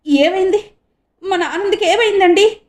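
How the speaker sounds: high, loud frightened questions; alarmed. A narrator's reading. Mother begs her husband for news of their son, alarmed. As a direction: beg her husband to tell her. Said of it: "ఆందోళనగా అంది".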